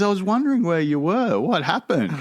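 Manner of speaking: high, sing-out mum voice; imitated wondering mum. Mum finds son on the footpath, mock high-pitched wondering. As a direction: call it up high as the puzzled mum.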